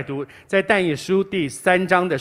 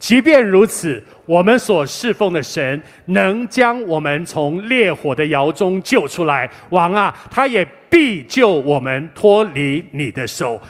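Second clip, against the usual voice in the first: slow, louder, deliberate reading of scripture; solemn scripture reading; 2 semitones higher, 6 dB louder, slower than usual. Shown as a demonstration. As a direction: read it aloud together, weighty and slow. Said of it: "一起来读， 请。".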